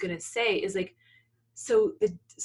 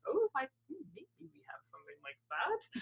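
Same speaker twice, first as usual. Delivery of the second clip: hesitant librarian; 6 semitones higher, 7 dB quieter than usual. Retold or imitated. Librarian answering a mother's odd request, hesitant. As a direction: lightly mimic a baffled, unsure helper.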